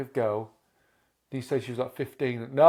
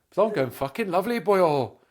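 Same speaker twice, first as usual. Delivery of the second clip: higher-pitched mock Welsh accent; comedy Welsh accent; 5 semitones higher, 4 dB louder than usual. Shown as a demonstration. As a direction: put on a jokey Welsh lilt. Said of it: "Speak, uh, Welsh in your head".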